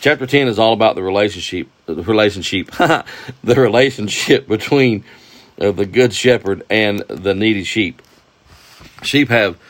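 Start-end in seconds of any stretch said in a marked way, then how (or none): 2.7-3.4 a quick embarrassed chuckle; brief real laugh after tripping over a word; short laugh. Real, not acted. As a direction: laugh at your own stumble. Stumbles repeating relationship, laughs.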